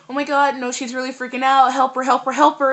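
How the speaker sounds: mock panicked bystanders yelling for help; retold alarmed shouting. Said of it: "people were just like".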